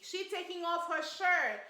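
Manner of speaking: ordinary delivery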